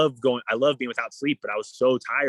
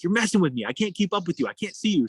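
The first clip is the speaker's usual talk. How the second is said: higher, half-joking exasperated whine; complaining to Laura she's too far ahead; mock frustrated complaint; 7 semitones higher than usual. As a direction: replay your annoyance at a faster swimmer. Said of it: "I'm getting pissed".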